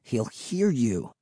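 soft, slow, tense warning; slow hushed caution. A narrator's reading. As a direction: quietly warn a friend of the danger. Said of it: "Will warned".

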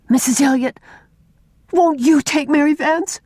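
put-on high, pleading child's plea; beseeching. A narrator's reading. Una begs Miss Cornelia to adopt Mary, beseeching. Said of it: "she said beseechingly".

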